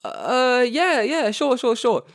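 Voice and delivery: high, hesitant mock yes; awkward agreeing. Runner retells agreeing to run, awkward high voice. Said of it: "And I'm like".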